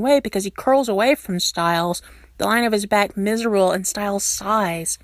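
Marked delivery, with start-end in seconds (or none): none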